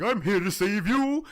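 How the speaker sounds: high, loud heroic declaration; mock superhero arriving to the rescue; All Might. Fighter pulls up to save her, grandiose hero act. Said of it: "Thinking he was All Might".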